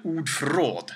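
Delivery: ordförråd in native Swedish sounds; ordförråd with Swedish vowels; Swedish pronunciation. Shown as a demonstration. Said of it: "in Swedish".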